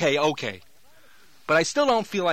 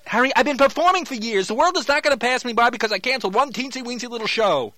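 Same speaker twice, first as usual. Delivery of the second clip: higher, louder indignant protest; 6 semitones higher, 5 dB louder than usual. Acted. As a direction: insist proudly.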